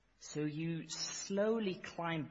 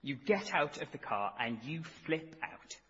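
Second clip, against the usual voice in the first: ordinary delivery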